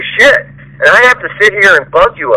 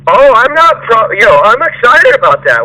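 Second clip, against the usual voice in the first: much higher, sarcastic imitation of fake enthusiasm; a hammed-up, pitched-up impression of fake eagerness; mock eager employee; 7 semitones higher than usual. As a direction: parody the worker's hollow excitement. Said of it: "it does take work".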